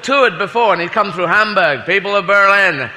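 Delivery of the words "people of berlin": slow, grand mock Kennedy speech; mock Kennedy accent. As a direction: orate it like Kennedy, slowly.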